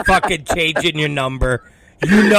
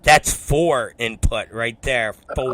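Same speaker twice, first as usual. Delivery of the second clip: slow, lower, softer mock girlfriend voice; mock confession; 6 semitones lower, 3 dB quieter, slower than usual.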